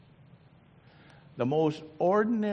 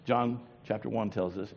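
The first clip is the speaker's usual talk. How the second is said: ordinary talk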